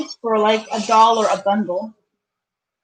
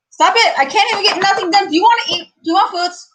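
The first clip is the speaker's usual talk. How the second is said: angry crying; 7 semitones higher, faster than usual. Real, not acted. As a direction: wail at the pestering cats. Frazzled by cats, high pitched.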